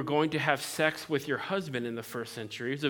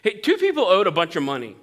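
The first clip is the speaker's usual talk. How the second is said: animated telling; 3 semitones higher, 6 dB louder than usual. Acted. Jesus telling parable to Simon.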